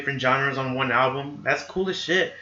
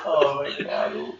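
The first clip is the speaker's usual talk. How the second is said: laughing; 7 dB quieter, much slower than usual. Real, not acted. Laughing fit.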